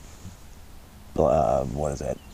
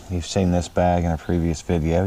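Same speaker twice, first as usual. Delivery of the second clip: ordinary talk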